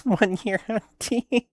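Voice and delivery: real laugh breaking into the words. Real, not acted. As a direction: crack up while reading it out. Amused by meme photo.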